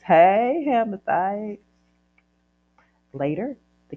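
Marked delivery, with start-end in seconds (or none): 0.0-1.6 playful cheer. Real, not acted. Cheers at the last tricky name.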